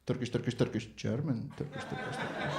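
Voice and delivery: low, hushed mock-Turkish muttering; conspiratorial babble. Doner guy confers with mate about the German, hushed. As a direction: mutter it secretively, fading to a whisper.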